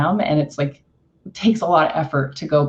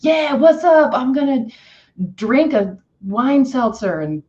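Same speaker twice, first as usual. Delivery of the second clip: Friday party hype; 6 semitones higher than usual. Retold or imitated. Imitating a Friday listener hyped by the song. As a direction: sound ready for the weekend. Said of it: "on Friday and you're like".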